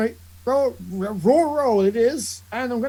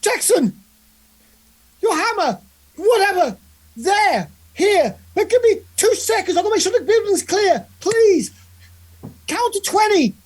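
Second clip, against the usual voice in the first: high, raised voice in short bursts; urgent calling out; 10 semitones higher, 4 dB louder than usual. Acted. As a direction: call orders across to an ally. Character calls urgently to ally.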